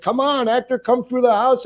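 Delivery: very high-pitched imitation of a woman; high, excited mock invitation; acted eager invitation. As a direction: pitch it way up, waving someone in. Lady inviting the actor, retold.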